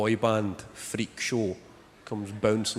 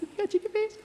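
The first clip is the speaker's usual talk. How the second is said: squealing at the newborn at the very top of the voice; squeaky baby talk. Retold or imitated. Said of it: "they can just go".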